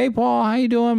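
high, singsong hello; cheery mock greeting. Neighbor greeting neighbor every day, high friendly call.

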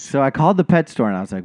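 normal talking voice

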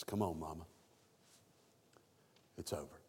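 low, soft voice of John leading Mary away; John comforting Mary. Retold or imitated. John to Mary at the cross, soft and low. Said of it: "John takes her and says".

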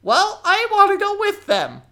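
very high, loud pleading goblin; young goblin begging to come along. Acted. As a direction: whine it like an eager little goblin. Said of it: "this young goblin".

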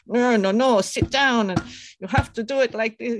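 put-on high, loud Victorian scolding accent. Victorian elder ordering a child to sit, put-on scolding accent. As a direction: lay down the rules in a mock Victorian accent. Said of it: "I'm putting that accent on".

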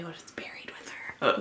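hushed spooky whisper. Real, not acted. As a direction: whisper it like a secret. Spooky guess at the doll.

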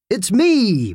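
big booming announcement. Acted. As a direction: boom out the arrival. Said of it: "boomed Uncle Gillian".

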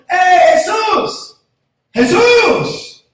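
very high, loud, slow yells; calling out. Acted. Blind men yelling for Jesus across the crowd, loud calls. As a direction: call for Jesus at the top of your voice. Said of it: "Lo digo tres veces".

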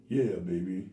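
put-on deep, low crooning "Yeah, baby". Co-host hears a deep soul-crooner purr.

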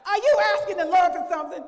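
high, very loud mock interrogation; reluctant challenge. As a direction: demand it loudly, hoping for a no. Retells challenging the sister, unhappy.

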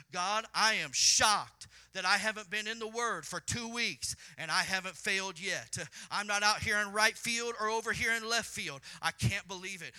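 loud preacher's cry of disbelief; full-force preaching. Acted. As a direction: preach it out to the back row.

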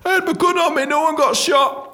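high, protesting mock-guard voice; defensive guard. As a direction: protest your innocence in a comic squeal.

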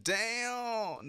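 high drawn-out stunned damn; shocked "damn". Retold or imitated. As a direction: react like you can't believe it. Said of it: "I'm like".

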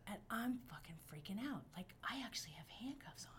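soft, breathless whisper; panicked whisper. Retold or imitated. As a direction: whisper like you can't believe it.

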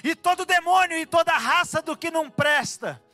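full-force preaching. Real, not acted. Preacher urging congregation to claim Jesus's name, fervent proclamation. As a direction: command fear and sickness to go, drawing out the key words.

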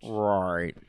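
low, drawn-out villain catchphrase; Doctor Evil. Retold or imitated. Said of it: "Doctor Evil was like".

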